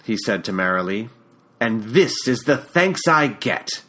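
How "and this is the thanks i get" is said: put-on slow, bitter British accent; sarcastic British-accented reproach. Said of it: "in that British accent of his".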